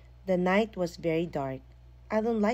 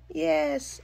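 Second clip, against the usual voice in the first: bawling; 10 semitones higher, 3 dB louder than usual. A narrator's reading. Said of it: "I bawled".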